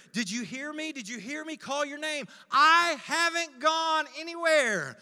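building high and loud, slowing at the end; insistent reassurance. Retold or imitated. Good Shepherd calling his name, loudly insistent. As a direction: proclaim your presence with force. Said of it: "And he says to me".

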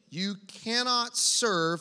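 drawn out, leaving the line hanging; slow cue for the room to finish; slow leading prompt. Shown as a demonstration. Pastor pausing mid-verse so the church finishes it, slowly. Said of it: "finish it for me".

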